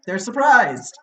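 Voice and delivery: high, loud gasp of surprise; spring it on them with a jolt; sudden shocked reveal; mock surprise.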